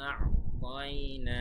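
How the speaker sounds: আ'তাইনা chanted loud and slow, catching the throat after আ; careful ayn. Shown as a demonstration. Teacher points students to the ayn, slow loud আ'তাইনা. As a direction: draw out আ'তাইনা with a clear ayn after the first vowel. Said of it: "এখানে আইনটা কিন্তু খেয়াল".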